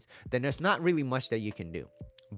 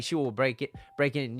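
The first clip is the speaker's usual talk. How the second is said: ordinary talk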